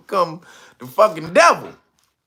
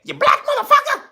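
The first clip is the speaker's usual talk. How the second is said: high screeching mock old aunt; aunt yelling; 3 dB louder, faster than usual. Mean aunt yelling at young [name], high mock screech. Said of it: "Used to call me".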